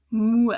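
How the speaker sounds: "mu" lang und laut gezogen; "mu" als offene lange Silbe; long mu. Shown as a demonstration. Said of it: "The first O is open".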